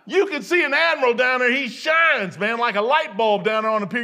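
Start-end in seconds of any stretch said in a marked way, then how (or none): none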